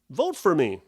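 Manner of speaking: high, bright mock girl pitching her vote; a young canvasser chirping her pitch, lightly imitated; chirpy campaigner. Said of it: "She says".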